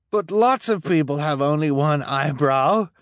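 high pleading cry; desperate protest. Acted. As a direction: protest in panic. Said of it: "Jacques cried".

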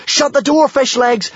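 very high, panicked screech. A narrator's reading. Kamikaze and Hiccup order Fishlegs, screeching. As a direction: screech it as an urgent command. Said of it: "screeched Kamikaze and Hiccup".

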